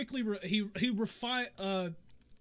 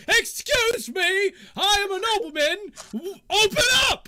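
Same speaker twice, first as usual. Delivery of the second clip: indignant shout; 13 dB louder than usual. Acted. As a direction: holler through a locked door like an outraged noble. Nobleman bangs on the locked door.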